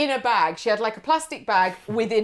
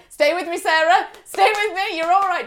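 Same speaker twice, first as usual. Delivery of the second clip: louder, higher pleading driver; urgent reassurance; 6 semitones higher, 5 dB louder than usual. Retold or imitated. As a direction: coax her to hold on. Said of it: "he was like".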